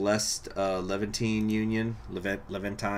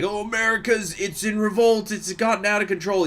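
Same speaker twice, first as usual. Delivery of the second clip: mock alarm; 10 semitones higher, 5 dB louder than usual. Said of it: "events that are saying, like".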